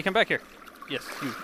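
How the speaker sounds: usual speaking voice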